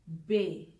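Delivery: बे, German letter name. Shown as a demonstration. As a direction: say बे with a short clean e. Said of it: "सी को German में थोड़ा सा press". (German उच्चारण बे)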